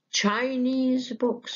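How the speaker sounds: slow, high-pitched stress on Chinese. Shown as a demonstration. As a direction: show where the stress lands, on Chinese. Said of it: "شوف الاسترس جت فين؟".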